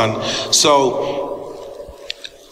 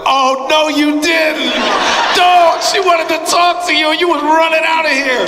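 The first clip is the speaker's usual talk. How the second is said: much higher and louder, laughing disbelief; mock scolding shout; 14 semitones higher, 4 dB louder than usual. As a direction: yell at him like the teasing baristas. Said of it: "all the kids in the Starbucks was like".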